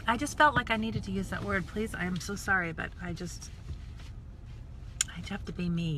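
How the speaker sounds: ordinary delivery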